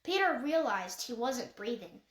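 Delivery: ordinary delivery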